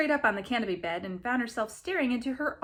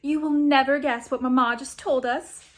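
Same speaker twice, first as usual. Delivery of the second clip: put-on louder, bubbling girlish voice; excited girl; 3 semitones higher, 8 dB louder than usual. A narrator's reading. Girl bursting in with news, excited. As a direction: gush the news. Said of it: "cried the first".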